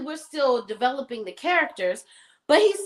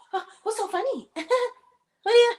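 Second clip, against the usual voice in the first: mocking jealous wife; 12 semitones higher than usual. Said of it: "she was very jealous".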